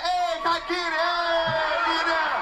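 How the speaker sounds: mock hype man; hyped-up friend. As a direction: hype the cotton candy like a friend filming. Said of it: "He take the picture like".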